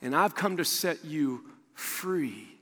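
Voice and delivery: slow, softened, lingering delivery; slow and hushed reassurance; tender promise. Acted. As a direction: ease down gently into a promise. God's tender promise, dropped and slowed.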